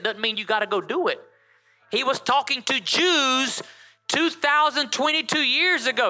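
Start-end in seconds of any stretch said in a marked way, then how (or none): 1.9-6.1 emphatic preaching. Real, not acted.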